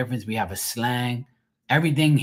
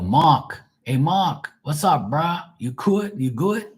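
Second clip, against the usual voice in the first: casual Bermudian street greeting, higher pitched; Bermudian accent; 6 semitones higher, slower than usual. Retold or imitated. Locals greeting the speaker by name, Bermudian accent. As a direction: greet a buddy in Bermudian slang, lilting upward. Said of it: "But people go".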